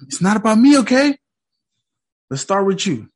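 a mocking therapist; doctor's voice. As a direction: mimic the doctor, sweetly pushy. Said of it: "We'll do it your way".

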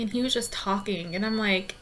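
ordinary talk, nothing marked

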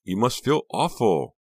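high, loud, drawn-out sympathy; gushing cry. A narrator's reading. Honey consoling reluctant Becca, crying out with sympathy. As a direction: pour on the sympathy, high and slow. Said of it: "Honey cried".

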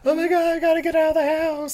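high, fast, hammed-up panic; panicked scream. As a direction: shriek like the house is burning. Acted panic over a house fire.

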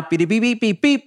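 high-pitched run of beeps; imitated beeping. Host mocking the switch to cop cars, imitated beeping. Mimics transition beeps between song themes.

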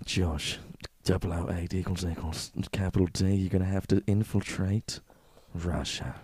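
low, hushed mission briefing; spy agency handler. Acted. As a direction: give a grave secret-agent briefing, low and soft. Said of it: "they're like".

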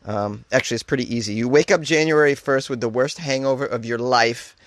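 ordinary talk, nothing marked

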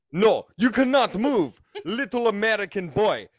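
hammed-up Russian accent, ordering him to stay still; mock Russian dominatrix. Imagined Russian girl bossing the little American boy in a mock Russian accent. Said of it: "be like".

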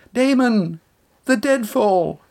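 high, falling cry of warning; shrill scream. A narrator's reading. Bronwyn warns Damon of the deadfall, shrill high cry. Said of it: "Bronwyn screamed again".

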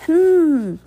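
loud high gagged "hmm"; muffled hum of agreement. A narrator's reading. Gagged judge agrees from behind tape, loud high hum. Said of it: "said in agreement behind the tape".

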